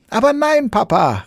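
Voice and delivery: very high, loud cheerful cry; Sams calling out. Acted. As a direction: call out merrily to Papa. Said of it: "rief das Sams und lachte".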